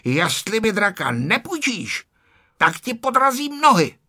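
put-on high-pitched, heated boyish threat; angry shouting. A narrator's reading. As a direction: threaten crossly, rising in pitch. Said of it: "Ferda se začal zlobit a křičel".

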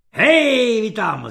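loud, high shout that drops at the end; roared call. A narrator's reading. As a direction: roar at someone across the room. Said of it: "zařval generál". (zařvané zvolání)

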